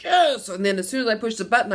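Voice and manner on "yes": high, loud yelp of triumph; retold burst of glee at the ballot; triumphant yes. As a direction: cheer it like you just won. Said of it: "I'm like".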